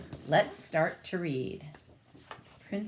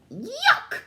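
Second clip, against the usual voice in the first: high, loud disgusted shriek; queen's shriek; 8 dB louder than usual. Acted. Queen smells the diaper, shrieking in disgust. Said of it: "shrieked the queen".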